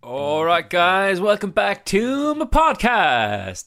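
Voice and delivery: high, slow goofy host intro; wacky voice. Pretend. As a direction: ham up a cheesy podcast welcome. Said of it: "doing the, um, the kind of wacky voice thing".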